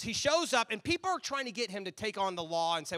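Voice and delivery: usual speaking voice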